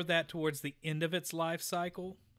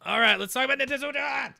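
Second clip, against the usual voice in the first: excited; 9 semitones higher, 10 dB louder, much faster than usual. Acted. Segment announcement.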